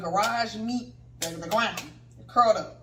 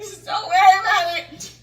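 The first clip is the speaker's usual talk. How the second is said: slow, high, loud mock wail; crying; 9 semitones higher, 9 dB louder, slower than usual. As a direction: wail it out as if in tears. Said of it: "I'm crying".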